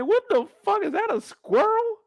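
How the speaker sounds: high-pitched shocked squeal; shocked disbelief. Retold or imitated. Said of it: "I said".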